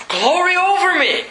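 high, bright exclamation of triumph; excited Moses. Acted. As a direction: burst out in delight. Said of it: "Moses is excited".

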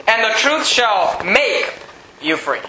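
"make" drawn out slowly, then a pause before "you free"; stress on make. Real, not acted. Preacher quoting the verse to the congregation, stress on "make". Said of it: "I like to emphasize that word".

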